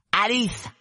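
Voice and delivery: very high, loud call; shrill little shout. Acted. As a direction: cry the name out in a small piercing voice. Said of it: "cria très fort de sa petite voix aiguë". (cri très fort, petite voix aiguë)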